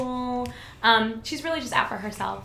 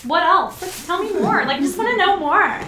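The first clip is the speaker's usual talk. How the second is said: loud, higher, insistent pestering; eager questioning; 8 semitones higher, 9 dB louder than usual. Acted. Player showing how her character pesters others, eager and loud. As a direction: press eagerly for more, pitched up. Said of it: "I feel like I just continually ask questions".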